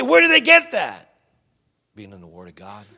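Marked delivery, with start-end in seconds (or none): 0.0-1.1 high, loud mock question; hammed-up, pitched-up demand; incredulous questioner. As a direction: cry it out as an amazed listener. Voices a questioning listener.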